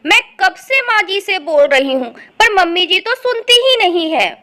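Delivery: loud, frustrated wife; fed up and insistent; exasperated complaint. Acted. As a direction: grumble loudly about being ignored. Wife complains about her mother-in-law.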